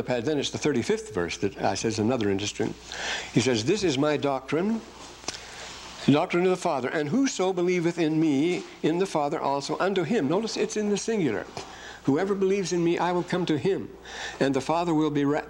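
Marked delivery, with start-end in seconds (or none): 3.6-10.3 scripture reading. Acted. 12.0-15.5 scripture reading. Acted.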